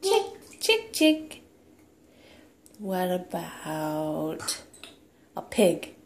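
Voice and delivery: put-on playful sing-song toddler talk; reading to a toddler. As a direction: coax the toddler with animal sounds. Animal sounds game with toddler.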